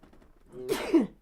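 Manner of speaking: sharp real cough; a sudden hard cough. Real, not acted.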